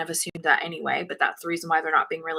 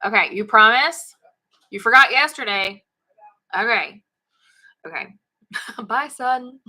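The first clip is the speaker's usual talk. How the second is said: fretful worried mom; 3 semitones higher, 5 dB louder than usual. Real, not acted. Mom to son leaving by bike.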